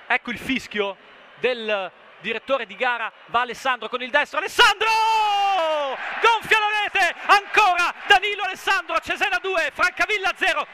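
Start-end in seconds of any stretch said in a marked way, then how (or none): 4.4-10.6 loud, high second goal cry; breathless italian goal commentary. Real, not acted. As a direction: celebrate the goal at full voice. Second home goal scored.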